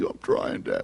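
whispered tearful plea; tearful boy. Retold or imitated. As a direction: plead through tears in a whisper. Crying son pleads with father.

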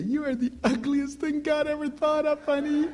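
put-on high, cooing baby talk with a laugh; sugary, high, laughing baby voice; sweet sing-song to baby. Shown as a demonstration. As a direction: coo nasty words sweetly to a baby. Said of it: "you can be smiling a little baby".

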